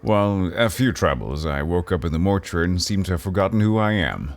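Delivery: usual speaking voice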